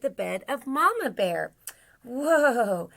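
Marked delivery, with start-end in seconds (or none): 2.0-2.9 long, loud, higher whoa; a drawn-out storybook cry of surprise; startled Santa. Acted. Santa rolls off the bed.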